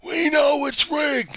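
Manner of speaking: high, loud, drawn-out mock yell; stubborn insistence. Election denier insisting to a doubter, slow high yelling. Acted quarrel, denier's side.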